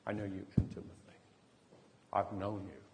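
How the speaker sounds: hushed, heartfelt avowal; tender confession. Retold or imitated. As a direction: let each phrase land softly, with space between. Heartfelt confession to a stranger, retold.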